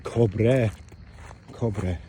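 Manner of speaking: "cobre", ending in "eh" instead of "a"; dad's pronunciation. Retold or imitated. Son recalling how dad said "cobre", KOB-reh pronunciation. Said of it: "that's how you said it".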